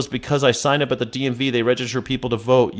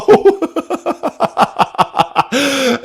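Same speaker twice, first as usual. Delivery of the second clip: high burst of laughter; 12 semitones higher than usual. Real, not acted. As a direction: let a big laugh burst out. Laughs at own sarcasm.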